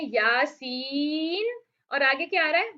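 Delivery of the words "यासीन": यासीन drawn out slowly in recitation; recited word. Shown as a demonstration. Teacher showing the word to students, drawn-out recitation. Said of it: "अब यहाँ पर ये देखें".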